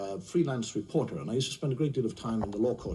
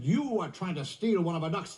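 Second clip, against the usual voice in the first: high-pitched officious accusation; accusing park keeper; 7 semitones higher than usual. Acted. Park keeper accusing a drunk of stealing, officious indignation. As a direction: accuse him outright, pitch raised.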